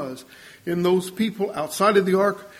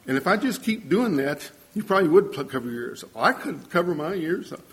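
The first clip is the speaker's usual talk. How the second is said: ordinary talk, nothing marked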